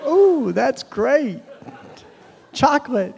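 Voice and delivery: high, drawn-out pretend enjoyment; put-on, high-pitched appreciation; faked delight. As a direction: feign loving a taste you avoided. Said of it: "fake a lick".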